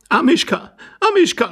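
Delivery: high, loud calls for a lost pet; high-pitched, urgent lady's cry; lady calling out. A narrator's reading. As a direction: call out as an agitated lady. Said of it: "rief die Dame".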